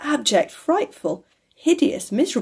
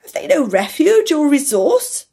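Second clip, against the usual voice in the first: high, loud pleading question; distressed cry; 6 semitones higher, 5 dB louder than usual. A narrator's reading. Scrooge cries out to the spirit for the children, pleading. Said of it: "cried Scrooge".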